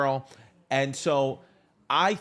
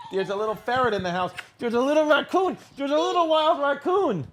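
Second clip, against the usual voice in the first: playful high voice; 11 semitones higher than usual. Acted. Dad voicing playful baby talk.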